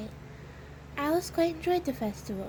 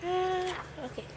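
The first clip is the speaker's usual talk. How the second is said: usual speaking voice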